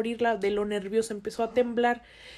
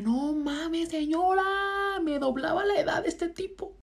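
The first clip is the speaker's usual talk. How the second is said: mock indignant protest; 6 semitones higher than usual. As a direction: protest to the lady in exasperated disbelief. Acted indignant reply to the lady.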